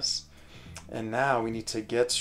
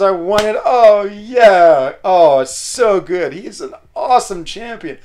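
raised, loud gushing delight; real happy hooray; excited cheering; 6 semitones higher, 10 dB louder than usual. Real, not acted. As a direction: keep celebrating out loud. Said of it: "oh, I'm so excited".

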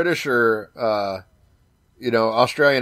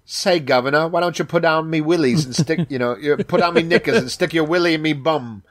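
cheeky Cockney-style British woman, higher pitched; mock British come-on, pitched up; British accent; 4 semitones higher than usual. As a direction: put on a saucy British accent. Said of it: "a chick with a British accent".

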